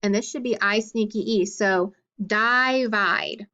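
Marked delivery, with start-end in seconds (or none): 2.2-3.5 "divide" drawn out as DIE-vide; long I. Shown as a demonstration. Teacher testing a split for students, long-I "divide". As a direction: give "divide" a long eye in both syllables. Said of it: "my I should be long".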